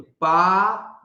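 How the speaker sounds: long slow vowel demo; drawn-out vowel. Shown as a demonstration. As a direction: hold the आ of पा long for the class. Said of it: "वी".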